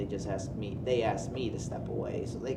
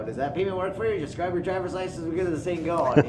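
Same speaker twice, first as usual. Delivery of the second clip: pushy salesman; 8 semitones higher, 6 dB louder than usual. Retold or imitated. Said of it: "at the end you're gonna be like".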